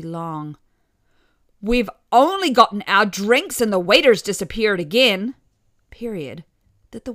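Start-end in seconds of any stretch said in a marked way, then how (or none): 1.6-5.3 louder, impatient grumble; complaining mother. A narrator's reading. Mother complains about the waiter.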